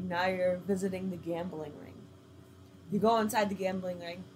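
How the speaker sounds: usual speaking voice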